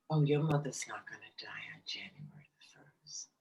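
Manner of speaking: soft hushed whisper, gently comforting; whispered reassurance. Retold or imitated. Reader reassuring a grieving daughter, whispered. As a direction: breathe it like a secret comfort.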